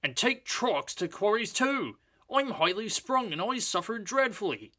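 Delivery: high, whiny little engine voice; complaining engine. A narrator's reading. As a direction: moan about being badly sprung. Sir Handel complaining.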